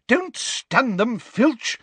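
high, slow angry shout; Umbridge angry shout. A narrator's reading. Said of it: "shouted Umbridge angrily".

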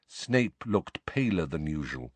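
usual speaking voice

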